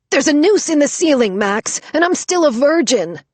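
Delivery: high, loud terrified wail. Acted. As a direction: blurt it in total panic. Terrified wailing.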